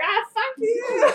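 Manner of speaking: high drawn-out comeback; slow whiny fuck-off; mock retort. Clones answering the your-mom joke, high and slow. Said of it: "They're like".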